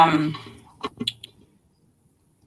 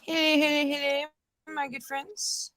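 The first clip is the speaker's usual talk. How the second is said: streamer greeting; 8 semitones higher, 11 dB quieter than usual. Acted. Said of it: "[name], when you going live again".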